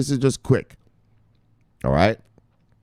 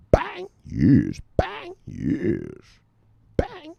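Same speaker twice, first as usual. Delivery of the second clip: playful two-pitch routine; 4 semitones higher, much slower than usual. Pretend. Routine of squeaked bang, low yes.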